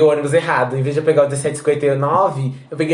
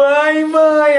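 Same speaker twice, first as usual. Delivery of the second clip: put-on high, loud childish cry for mum; mock child's relieved cry; little child calling mum; 11 semitones higher, 5 dB louder than usual. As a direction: call out like a small lost kid. Child hugging stranger.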